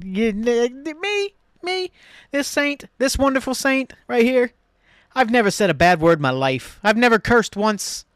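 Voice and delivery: high-pitched, hammed-up protest of innocence; mock innocence. Host jokingly defends his spotless record, mock innocence.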